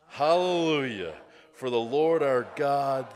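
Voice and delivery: loud exclamation of praise; crying out. A narrator's reading. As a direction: proclaim it loudly, like a multitude. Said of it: "crying out".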